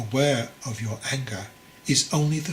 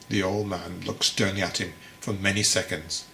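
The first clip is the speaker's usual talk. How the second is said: ordinary delivery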